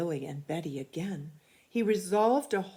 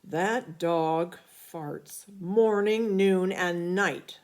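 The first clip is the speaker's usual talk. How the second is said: slow, louder grumbling dad voice; exasperated father; 7 dB louder, much slower than usual. A narrator's reading. Father complaining to the family about the dog, slow and loud. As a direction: drag each word out in disgust.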